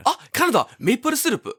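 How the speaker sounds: put-on excited high, loud Japanese-accented English. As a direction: play an amazed Japanese fan, pitch way up. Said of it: "I'll be Japanese".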